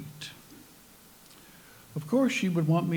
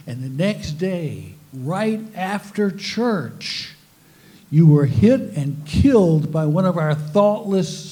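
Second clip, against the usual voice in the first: mock preaching; 5 dB louder than usual. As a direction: preach it like a fire-and-brimstone pastor. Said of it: "He would say".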